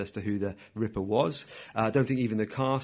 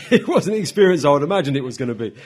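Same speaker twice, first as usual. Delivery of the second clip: laughing; 3 semitones higher, 9 dB louder than usual. Real, not acted. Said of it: "laughing at own story".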